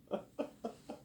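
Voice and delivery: soft, breath-only laugh; voiceless laugh. Real, not acted. Laughing at turning the actors down, soft breathy laugh. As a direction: let out a quiet breathy laugh.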